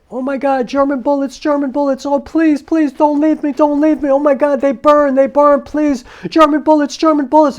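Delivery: high, loud frantic pleading scream; screaming in terror. Retold or imitated. Son acting out his dying father's nightmare, screaming in terror. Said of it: "he starts screaming, and I mean screaming".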